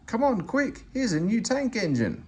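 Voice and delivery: put-on little boys shouting at the platform; shouted. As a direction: call your friends over, thrilled. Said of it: "Some little boys shouted".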